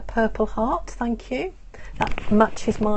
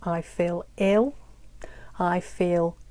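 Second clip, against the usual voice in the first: slow model phrases; slower than usual. Shown as a demonstration. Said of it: "So you can say".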